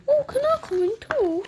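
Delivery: quick, high-pitched little bird. Acted. The bird asks to join them, quick high bird voice. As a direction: put on a high, hurried bird voice. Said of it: "And then the bird's like".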